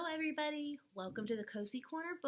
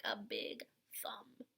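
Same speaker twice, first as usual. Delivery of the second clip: whispered punchline; 4 semitones lower, 5 dB quieter than usual. Acted.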